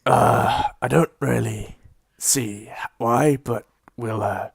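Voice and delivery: hesitant, low-key dwarf character voice; a hint of a reluctant dwarf, halting over the words; lightly voiced dwarf shopkeeper. Dwarf putting off a visitor until later, hesitant.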